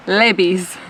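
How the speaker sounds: LEVI'S as "LEH-bees"; Levi's the Spanish way; Spanish pronunciation. Shown as a demonstration. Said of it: "how they say it in Spanish".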